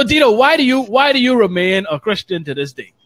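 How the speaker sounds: ordinary talk, nothing marked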